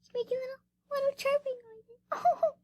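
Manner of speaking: high squeal of delight; squealing aww. Real, not acted. Vlogger squealing at a chirping kitten, very high. Overwhelmed by cute clip.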